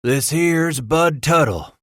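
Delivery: slow gruff frontiersman. Acted. Leader introduces man.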